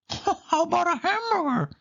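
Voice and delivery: very high squeaky mouse voice; chirpy high suggestion; Mickey voice. A narrator's reading. Mickey suggests a hamburger, high squeaky voice. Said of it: "said Mickey".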